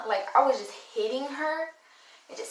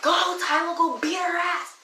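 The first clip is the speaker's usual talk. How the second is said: egging on a friend; 6 semitones higher than usual. Retold or imitated. Friend urging Tyler to go beat the girl, hyped and higher. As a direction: pump her up to go fight.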